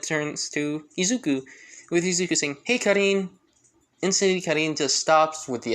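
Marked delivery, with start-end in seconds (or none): 2.6-3.3 called out cheerfully, loud and high; calling out. Acted. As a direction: call to her across the way. Izuku calls out to Karin.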